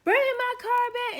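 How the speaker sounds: high, loud, slow yell; loud, high, furious mock caller. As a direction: holler it down the phone. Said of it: "someone yelling on the other line".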